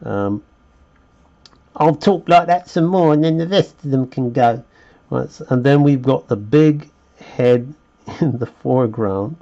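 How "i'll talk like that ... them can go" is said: higher, quick put-on Cockney; Cockney accent. Artist teasing the audience he may lose, Cockney accent. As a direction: keep up the joking Cockney. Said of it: "playing around with that Cockney accent".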